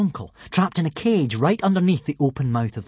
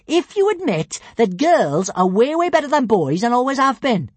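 high, loud, teasing; cheeky shout; 9 semitones higher, 4 dB louder than usual. Acted. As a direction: shout the dare. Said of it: "shouted Kamikaze".

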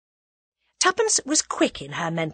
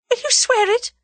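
high, loud pleading question; anxious wail; fearful plea; 6 dB louder than usual. A narrator's reading. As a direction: beg for a promise in a frightened high voice. Captive pleads in fear.

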